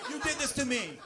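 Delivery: hammed-up accusing yell, half laughing; mock yelling wife. Movie wife yelling at husband in labor, mock yell. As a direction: play the movie wife blaming him, mildly.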